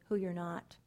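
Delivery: ordinary talk, nothing marked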